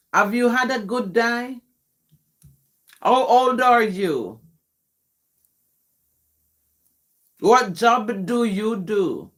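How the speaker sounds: careful standard English from a Jamaican speaker; demonstrated English. Shown as a demonstration. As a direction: spell out each question in proper English, phrase by phrase. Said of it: "That's English".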